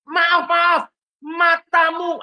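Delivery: loud, sneering repeat of the apology; furious driver mocks the apology; mocking angry driver. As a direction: throw his sorry back in his face.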